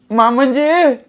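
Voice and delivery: high, loud tearful cry for help; calling out from the door. Acted. Shevanta cries out at Laxma's door, loud high call. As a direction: call out in distress. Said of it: "दारातनंच आवाज दिला तिनं".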